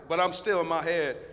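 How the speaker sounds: ordinary delivery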